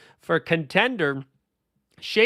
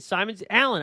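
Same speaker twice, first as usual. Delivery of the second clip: ordinary talk, nothing marked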